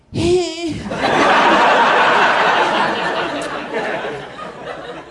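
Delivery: a high unvoiced kick squeal. Shown as a demonstration. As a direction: squeak like you're startled mid-kick. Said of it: "I make this sound. I go".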